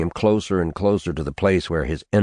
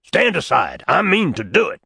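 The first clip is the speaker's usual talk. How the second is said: fervent shout, louder and higher; 12 semitones higher, 3 dB louder than usual. Acted. Old preacher at the schoolteacher's door, fervent shout. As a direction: proclaim it at the door like a prophet.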